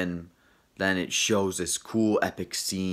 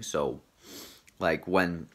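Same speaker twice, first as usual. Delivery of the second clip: ordinary talk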